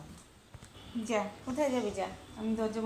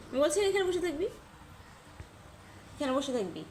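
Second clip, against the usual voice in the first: higher, sharp command to stay; owner's raised command to sit; firm order; 4 semitones higher than usual. Real, not acted. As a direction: insist it stays put.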